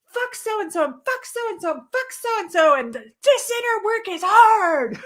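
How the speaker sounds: high-pitched rant building to a loud finish; venting yell. Retold or imitated. Venting anger at the lake, yelling. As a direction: rant in a high voice, stretching the last line. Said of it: "I'm yelling, and I'm like".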